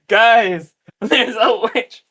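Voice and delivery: high-pitched mock warning, with a laugh; Minnie. Game master voices a helper backing up a friend, mock alarm. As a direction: stretch out a playful alarm to the crowd. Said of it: "Minnie's helping Boing Boing, being like".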